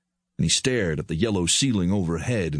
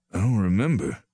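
strained tone. A narrator's reading. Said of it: "he reiterated in a strained tone".

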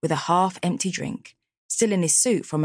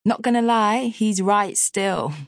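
forced laugh; 5 semitones higher, slower than usual. Acted. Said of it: "forcing out a laugh".

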